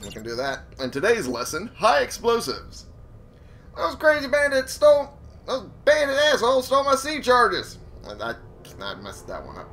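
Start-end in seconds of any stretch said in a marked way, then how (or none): 1.8-7.8 high-pitched crazy hermit complaining about bandits; crazy old hermit voice. Retold or imitated. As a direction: rant like an old man whose stuff got stolen. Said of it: "I don't know what the hell that voice was".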